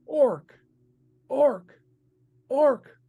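high, chanted yell, word by word. Acted. People in the vision yelling at the player, high chanted yell. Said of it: "people yelling".

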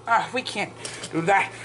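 ordinary talk, nothing marked

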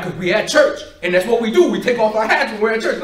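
retold frustrated outburst, loud and pressing. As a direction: raise your voice at a stubborn kid. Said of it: "Now I'm getting frustrated with this kid".

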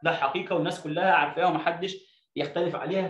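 ordinary delivery

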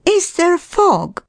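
very high, urgent woman's question; demanding lady. A narrator's reading. Lost woman questions the man, demanding. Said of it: "I demanded".

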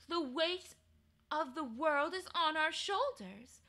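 condescending queen. Acted. Queen to Diggory on reasons of state, condescending. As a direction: talk down to the boy.

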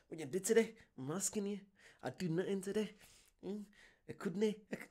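put-on light mix of Northern Irish and Scottish accent. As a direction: mimic the show's pal in Irish-Scottish accent. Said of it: "a bit of Irish mixed with Scottish".

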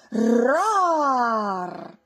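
loud over-the-top roar; hammed-up dinosaur roar; exaggerated roar. A narrator's reading. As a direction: roar way too much. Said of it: "Ruggisce in modo esagerato".

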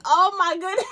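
giddy with joy; squealing delight. Retold or imitated. Excited realization retold.

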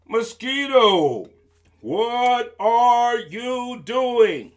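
very slow, loud, drawn-out question; big elephant voice. Acted. Elephant asking mosquito on the ground, big and slow. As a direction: stretch each word like a huge elephant.

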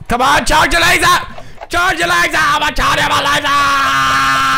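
high shouting that stretches into a scream; frantic yelling. Real, not acted. Player charging a laser in game, frantic yell. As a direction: urge it on frantically.

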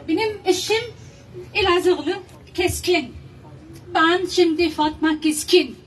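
ordinary talk

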